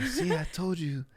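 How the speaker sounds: high, slow, mocking parent; a hammed-up "see, I told you" scolding. Dad mocking his son over a broken plate, high and slow.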